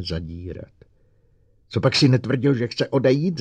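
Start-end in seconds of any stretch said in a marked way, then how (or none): 1.7-3.4 high, loud angry demand; raised, furious questioning; roaring. A narrator's reading. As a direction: bellow it as an accusation. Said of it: "zařval Bogert".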